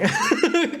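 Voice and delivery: brief high-pitched giggle; a quick, real chuckle; short laugh. Real, not acted. Streamer excusing the fidgeting to viewers, short laugh.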